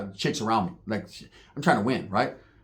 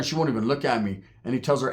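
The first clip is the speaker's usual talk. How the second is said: usual speaking voice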